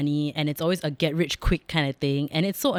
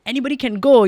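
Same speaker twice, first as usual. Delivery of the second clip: mock announcement; 8 semitones higher, 7 dB louder, faster than usual. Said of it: "they say".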